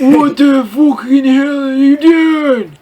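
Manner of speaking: hammed-up slow furious yell; mock angry shout. Reading the fanfic aloud, mock shouting at the intruder. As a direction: overact the outrage, slowly. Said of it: "I shouted angrily".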